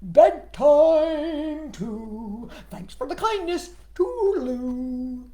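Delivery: a frog singing good night; sing-song frog goodbye. Acted. Froggy goodbye.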